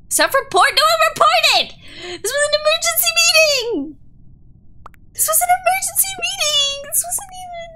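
very high, loud squealed protest; high-pitched outburst of indignation; indignant shouting. Real, not acted. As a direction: shriek in outraged disbelief. Protesting a mistaken self-report accusation.

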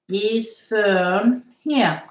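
"Is Fern here?" very slow, each word held apart; slow model. Shown as a demonstration. Teacher repeating the question for students, slowly spaced out.

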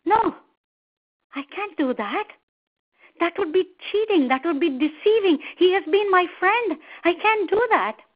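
appalled refusal, rising in pitch and force; taken aback. A narrator's reading. Crocodile refuses to betray his monkey friend, taken aback. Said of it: "is taken aback".

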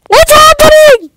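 very high, loud shout; shocked shout. Real, not acted. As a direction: shriek it out in shock. Sudden shock in game.

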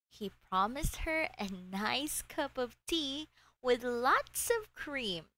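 ordinary delivery, nothing marked

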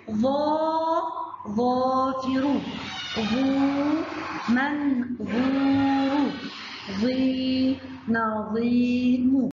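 slow stretched long vowels; slow sing-song review; slow review drill. Shown as a demonstration. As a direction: draw each word out for kids. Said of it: "مرة تانية يا ولاد".

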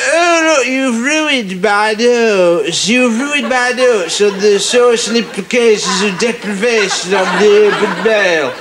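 high, prim student whining; a fussy student complaining about his notes; mock student voice. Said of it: "he got off and he said".

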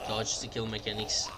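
slow breathy whisper. Pretend. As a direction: hush it like a secret move. Mock-epic whisper of a game term.

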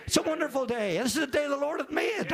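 high-pitched, joyful mock singsong; exuberant praise. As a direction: chirp it out, overjoyed to greet the day. Acts out own cheerful Monday reply.

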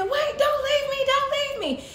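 very high, loud, hurried pleading; panicked plea. Acted. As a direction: squeal in mock panic at being abandoned. Goat panics as seal flees.

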